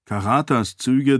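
usual speaking voice

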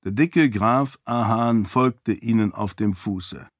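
ordinary delivery

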